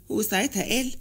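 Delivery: ordinary delivery, nothing marked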